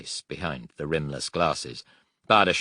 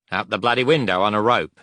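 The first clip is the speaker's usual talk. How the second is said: louder, fast and blunt; blunt quick answer; 4 semitones higher, 7 dB louder, faster than usual. Acted. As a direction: fire it off bluntly.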